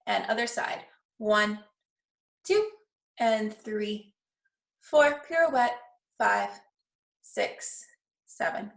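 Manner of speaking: counting steps aloud in rhythm; ballet class count. Shown as a demonstration. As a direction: call the counts like a dance teacher. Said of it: "counting the class through".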